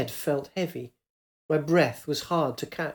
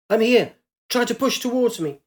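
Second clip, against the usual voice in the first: shouting; 7 semitones higher than usual. Acted. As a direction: call across the rushing water. Calling to woman in river.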